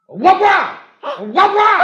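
loud, very high name call. Acted. Husband dared to use her name, shouts it high. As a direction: yell the name through the house. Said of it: "θα τη φωνάξεις τη χιονάτη σου".

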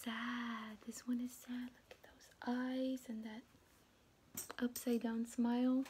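unvoiced, slow sad hush; soft whisper for the sad page. Shown as a demonstration. Sad page read softly.

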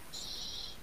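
quick unvoiced shush. A narrator's reading. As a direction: hush someone urgently. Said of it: "Habla más bajo".

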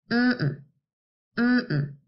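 two short closed-mouth hums; hummed syllables. Shown as a demonstration. Teacher hums table for the class. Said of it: "let's try humming the words".

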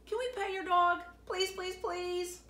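put-on high pleading kid voice, please after please; begging child. A narrator's reading. Laura begging Ichabeezer to pet his dog, high pleading. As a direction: beg like an eager little kid.

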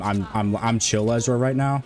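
usual speaking voice

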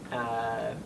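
ordinary talk

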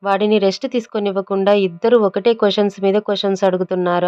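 plain reading voice

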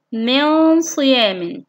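slow, held nasal noon in من صيام; ikhfa demonstration. Shown as a demonstration. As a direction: hum the hidden noon before the ص of صيام. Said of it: "حرف النون الساكنة". (إخفاء النون بغنّة طويلة في من صيام)

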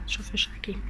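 breathy whisper, voice dropped. Real, not acted. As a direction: whisper it in the changing room. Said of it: "Tô falando baixo porque tô dentro do provador".

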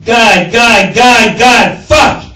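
loud frustrated cries of God; venting, near shouting; exasperated yell. Acted. President spotting the donor, exasperated yell.

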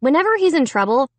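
ordinary delivery, nothing marked

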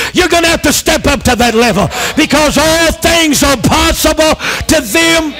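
high, shouted preaching; fiery pulpit shout, rising through; preaching at full force. Acted. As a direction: challenge them to believe. Preaching climax.

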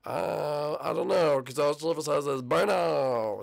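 slow, drawn-out silly creature voice; Birdo. Shown as a demonstration. YouTuber improvises a voice for Birdo, drawn-out and silly. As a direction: stretch each word into a long wail. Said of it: "I guess it's like".

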